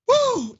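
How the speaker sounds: high-pitched whoop of release; loud woo. Real, not acted. Host winding down after a rant, whooping. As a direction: let out a high "woo" to blow off steam.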